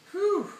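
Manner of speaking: loud, high "whew"; steadying exhale. Pretend. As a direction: blow out hard to regain composure. Composing himself after getting emotional.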